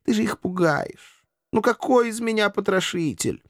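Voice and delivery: high-pitched, affected reproof; fussy aristocratic chiding; reproachful. A narrator's reading. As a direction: scold the pet with wounded protest. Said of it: "укоризненно покачал головой Эшли".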